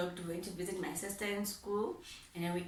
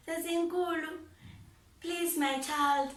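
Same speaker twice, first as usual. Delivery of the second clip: mother begging; 7 semitones higher than usual. Retold or imitated. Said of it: "she would beg".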